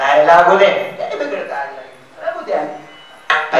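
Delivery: a hint of a careless old farmer; dismissive old man. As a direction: wave it off, let it burn. Old man shrugs off fire.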